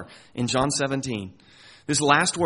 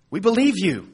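higher, louder firm declaration; asserting disciples; 5 semitones higher, 6 dB louder than usual. Acted. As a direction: insist on it like eager followers. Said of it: "So they're asserting".

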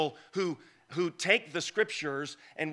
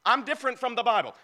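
mock apocrypha author; 8 semitones higher, 5 dB louder, faster than usual. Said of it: "You know what they're saying?".

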